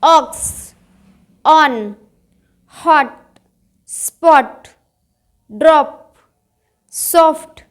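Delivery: words like हॉट and पॉट with open "aw", one by one; short open "aw" in ऑक्स, डॉट, गॉट. Shown as a demonstration. Teacher drilling o words, हॉट with a short open "aw". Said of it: "ओ का उच्चारण ओ होगा".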